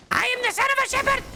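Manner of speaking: high fast hammed-up Rolf yell; Rolf shout. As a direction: bellow it like goofy Rolf. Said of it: "Rolf impression".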